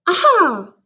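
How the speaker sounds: sudden delighted realisation; light eureka cry; excited aha. A narrator's reading. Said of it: "I thought".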